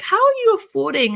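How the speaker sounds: high, questioning "How"; suspicious mom. Retold or imitated. Mom grilling her daughter about money, high and suspicious. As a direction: pitch up like a worried mother.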